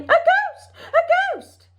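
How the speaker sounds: very high-pitched mock-panic cry; panicked yelling. A narrator's reading. Townspeople see an empty dressing gown, panicked yelling. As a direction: squeal it like a frightened crowd. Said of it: "everybody yelling".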